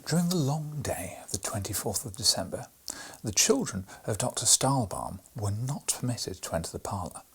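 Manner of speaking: ordinary delivery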